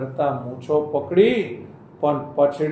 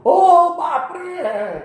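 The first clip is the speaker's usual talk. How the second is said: high, drawn-out mock cry of alarm; hammed-up clown shriek; clown's yell; 10 semitones higher, 3 dB louder, much slower than usual. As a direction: cry out high, stretching every word. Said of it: "ઝુમરૂ જોકરે બૂમ પાડી".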